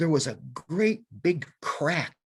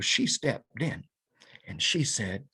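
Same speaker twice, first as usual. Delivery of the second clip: usual speaking voice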